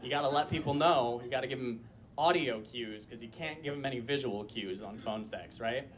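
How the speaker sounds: ordinary talk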